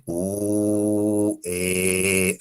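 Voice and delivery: "U", then "E", long, low and level; reversed held vowels. Shown as a demonstration. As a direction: stretch "U" into "E" on a steady tone. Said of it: "Al revés".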